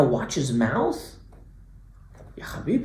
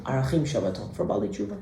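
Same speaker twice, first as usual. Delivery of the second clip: normal talking voice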